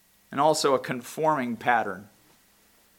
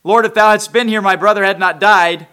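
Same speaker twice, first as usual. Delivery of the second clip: higher and louder, accusing tone; reproachful Martha; 7 semitones higher, 16 dB louder than usual. Retold or imitated. Preacher voicing Martha's reproach to Jesus. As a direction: throw the words at him as a complaint. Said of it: "Martha says the exact same thing".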